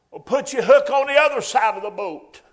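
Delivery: loud, high preaching climax; preaching at full force. Acted. Preacher booming the Lord's instruction to the fishermen. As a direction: drive it home from the pulpit.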